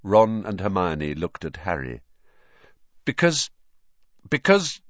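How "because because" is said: high, halting, hesitant start; nervous stalling. A narrator's reading. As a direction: stall while hunting for an excuse. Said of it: "his heart thumping very fast".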